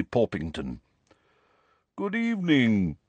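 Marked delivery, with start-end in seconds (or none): 2.0-3.0 courtly ghost's sing-out greeting; genial ghostly gentleman. A narrator's reading. Nick greets Harry on emerging from the wall, genial ghost.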